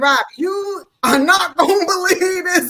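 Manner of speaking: loud, high, with a laugh breaking in; excited disbelief. Retold or imitated. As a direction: shout the amazing news through a laugh. Retold excited news to Robbie.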